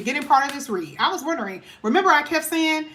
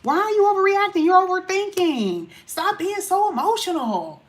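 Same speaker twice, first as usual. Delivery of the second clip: mock dismissive voice; 5 semitones higher than usual. Reader mocking a partner who calls you overreacting, condescending. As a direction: imitate someone brushing off your feelings.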